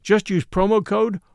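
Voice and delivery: upbeat, measured promo read; sponsor ad read. Acted. Host pitching BritBox to listeners, sponsor ad read.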